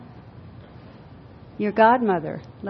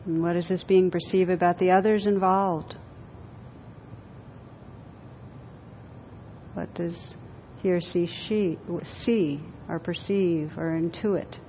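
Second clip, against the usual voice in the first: guided meditation; 3 semitones lower, 7 dB quieter than usual. Acted. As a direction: guide them gently inward, unhurried. Leads a guided visualization.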